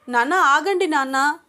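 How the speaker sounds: high, loud, urgent cry; shouting. A narrator's reading. Daughter stopping her father from attacking, urgent shout. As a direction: shout to make him stop. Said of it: "అని అరుస్తూ".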